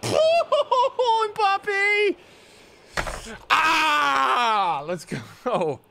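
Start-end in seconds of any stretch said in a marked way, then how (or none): 0.0-2.2 very high, loud laughing yell; screaming cheer through laughter; ecstatic scream. Real, not acted. Scores big goal, laughing. 3.5-4.8 high loud shout of triumph; short celebratory shout; joyful yell. Real, not acted. Celebrating the goal.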